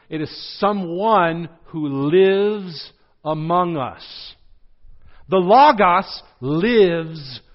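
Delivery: slow, loud, weighty emphasis; slow climactic emphasis. Shown as a demonstration. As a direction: land the revelation slowly.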